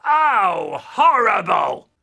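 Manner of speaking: loud, slow cry of disgust; disgusted shout. Acted. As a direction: shout it out, drawn out with revulsion. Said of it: "my brother suddenly goes".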